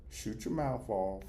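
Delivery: plain reading voice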